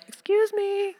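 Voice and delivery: very high, sing-song mock warning; calling out to kids. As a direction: call from the deck with playful warning. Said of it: "step out on the back deck and be like".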